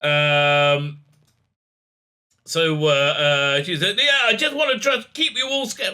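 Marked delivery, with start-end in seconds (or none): 4.0-5.9 high-pitched mocking imitation; patronizing. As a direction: talk down in a put-on high voice. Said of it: "patronizing the hell out of him".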